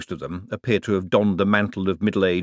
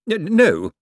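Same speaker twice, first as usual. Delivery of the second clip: firm; 11 semitones higher, 5 dB louder than usual. Acted. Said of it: "said Bent firmly".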